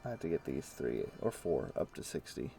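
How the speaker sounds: usual speaking voice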